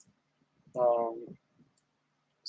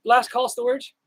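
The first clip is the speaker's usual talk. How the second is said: higher, calling out the name; 7 semitones higher, slower than usual. Real, not acted. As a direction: call out to someone in the crowd. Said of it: "Are you in here?".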